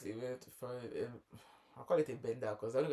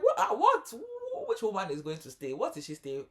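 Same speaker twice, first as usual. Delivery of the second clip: much higher, loud shocked what; shocked disbelief; 9 semitones higher, 8 dB louder than usual. Retold or imitated. Host reacting to woman staying, shocked and high. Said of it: "I'm like".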